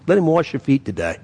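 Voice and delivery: ordinary talk, nothing marked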